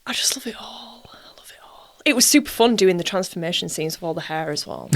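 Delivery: soft, breathless whisper; gushing in a hushed whisper. Real, not acted. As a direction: breathe it out softly, all air. Gushing quietly about the shoot.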